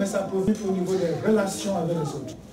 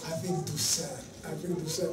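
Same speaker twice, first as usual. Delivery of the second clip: much softer, calm and tender plea; gentle, loving reproach; 5 semitones lower, 9 dB quieter than usual. Shown as a demonstration. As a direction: speak the complaint gently, with love. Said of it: "Tu es à la douceur, tu dis". (avec douceur, tout bas et tendrement)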